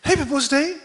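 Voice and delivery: a cheery high-pitched greeting; high cheery congratulation. Retold or imitated. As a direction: sing out a happy birthday wish. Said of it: "옆에 사는 말이".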